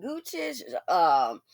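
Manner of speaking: ordinary talk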